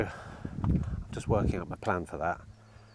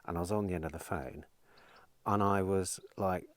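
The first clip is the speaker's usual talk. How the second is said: usual speaking voice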